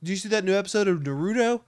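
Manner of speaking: mock anime fan. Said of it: "That's like when I say".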